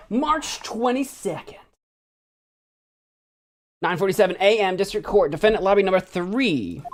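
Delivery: announcer read. Acted. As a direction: announce the date and place. Reading game date caption.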